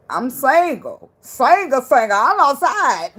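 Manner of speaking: high, loud, slow "single" chant; proud declaration. Pretend. Telling an ex she's single and outside, loud proud chant. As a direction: belt out being single.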